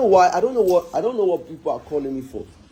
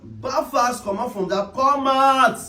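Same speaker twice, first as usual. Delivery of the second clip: calling out; 7 semitones higher, slower than usual. Pretend. Calling out to Mary again.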